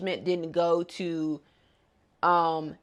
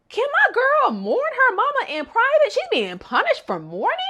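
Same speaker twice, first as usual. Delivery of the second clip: exasperated squeal; 4 dB louder than usual. Real, not acted. Outraged at Mio's punishment.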